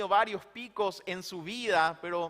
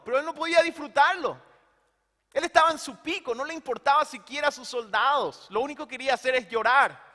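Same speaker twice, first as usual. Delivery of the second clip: fervent preaching; 5 semitones higher, 6 dB louder than usual. Real, not acted. Emphatic preaching peak.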